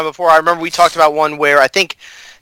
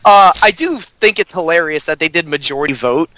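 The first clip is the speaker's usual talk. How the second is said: ordinary delivery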